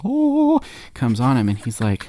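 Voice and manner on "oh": very high, loud angelic "oh"; heavenly light. Acted. Storyteller imitates light from heaven, high heavenly "oh". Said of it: "light from heaven's like".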